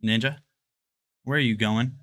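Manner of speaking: usual speaking voice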